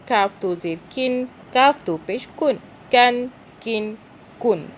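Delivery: کاف and کن with a light, thin K; thin kaf. Shown as a demonstration. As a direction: keep every K in کن light. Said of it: "یہ باریک ہے". (کاف باریک آواز میں)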